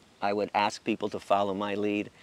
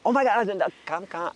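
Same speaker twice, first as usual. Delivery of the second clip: mock gushing invitation, pitched up; excited friend; 8 semitones higher, 4 dB louder than usual. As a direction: gush like an eager friend. Friend's excited reply on phone.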